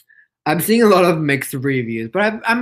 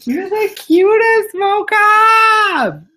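very high, loud pet talk; adoring squeal; 7 dB louder, much slower than usual. Real, not acted. Owner adoring Mocha the pet, squealing. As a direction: squeal it out, stretching the name.

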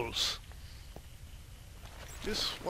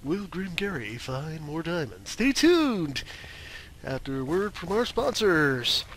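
booming TV cliffhanger, louder; announcer voice; 5 dB louder than usual. Pretend. Host teasing viewers before ad break, announcer voice. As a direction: hype it like a show before the break.

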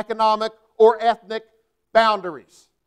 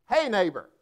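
ordinary talk

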